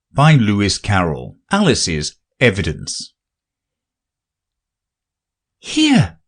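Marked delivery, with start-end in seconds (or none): 5.6-6.2 much higher eager call; cried out. Acted. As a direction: answer the summons in a flurry. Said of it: "cried Alice".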